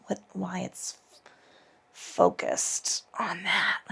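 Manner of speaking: slow unvoiced whisper. Real, not acted. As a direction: trail off into a whisper. Musing to herself mid-pose.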